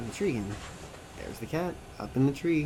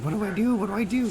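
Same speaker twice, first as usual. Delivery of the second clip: higher, louder mock panic; panicked; 9 semitones higher, 5 dB louder, faster than usual. Man with a cat stuck to his face, panicked. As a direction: blurt it out in helpless alarm.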